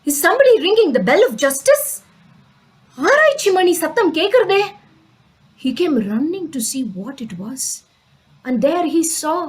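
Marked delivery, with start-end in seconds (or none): 0.1-4.6 high, astonished questioning; startled alarm. Acted. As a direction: react startled to an unexpected bell. King startled by the bell.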